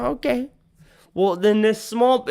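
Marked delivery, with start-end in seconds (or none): 0.0-0.5 squeaky high agreement; high okay. Retold or imitated. Agreeing to the small man, high and meek. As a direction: chirp it out high. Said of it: "I'm all like".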